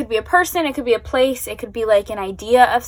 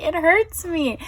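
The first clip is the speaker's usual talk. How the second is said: pained, repeated; 6 semitones higher, slower than usual. Real, not acted. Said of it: "I can't form words".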